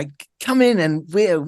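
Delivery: high, loud, bright commercial read; hammed-up bright young ad voice; bright young announcer. Shown as a demonstration. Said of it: "it's like the bright, bright".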